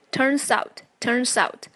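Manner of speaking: turns out as "turn-zout", no added vowel; linked pronunciation. Shown as a demonstration. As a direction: link turns straight into out. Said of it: "记得连读".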